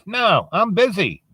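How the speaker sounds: high, slow dismissive refusal; old lady refusing. Acted. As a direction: send Death packing in a high voice. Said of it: "And she goes".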